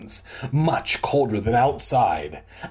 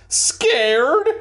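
SCARED pushed very high and loud, rising; stressed in italics; 13 semitones higher, 5 dB louder than usual. A narrator's reading. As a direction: squeal SCARED as an outraged question.

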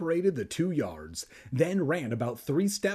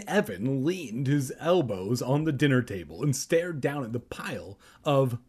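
ordinary delivery, nothing marked

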